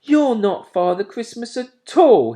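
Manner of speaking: put-on high, loud, cross child's complaint; disappointed owlet, loud and cross. A narrator's reading. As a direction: sulk at the letdown. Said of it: "he said crossly".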